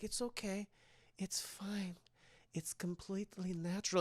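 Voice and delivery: very soft, hushed reassurance; imitated creepy coaxing. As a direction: coax in a hush, playing creepy Esther. Imitates Esther coaxing father.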